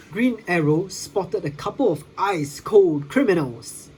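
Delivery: usual speaking voice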